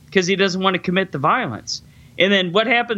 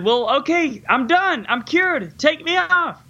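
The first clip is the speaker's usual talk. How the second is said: higher, hammed-up plea to be let off; mock begging patient; 9 semitones higher, slower than usual. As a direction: whine to be released, mockingly. [name] begs to stop treatment.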